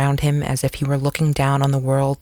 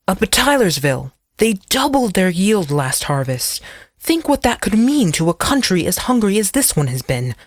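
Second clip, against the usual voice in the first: urgent, earnest plea; pleading zealot; earnest old man; 5 semitones higher than usual. Acted. Abner argues eagerly.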